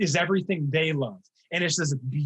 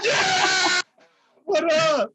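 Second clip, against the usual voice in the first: high whooping shout; excited cheer; 14 semitones higher, 4 dB louder, much slower than usual. Real, not acted. Host cheering a friend in chat, excited whoop. Cheers Timo watching the stream.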